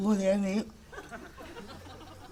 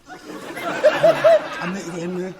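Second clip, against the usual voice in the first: laughing; 8 dB louder, much slower than usual. Acted. Laughing at own situation.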